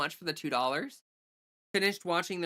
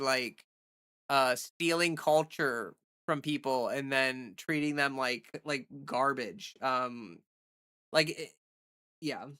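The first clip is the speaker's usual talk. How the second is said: ordinary talk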